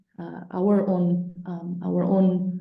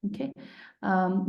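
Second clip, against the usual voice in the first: ordinary talk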